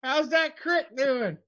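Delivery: high, put-on greeting voice; hammed-up high folksy greeting; catchphrase greeting.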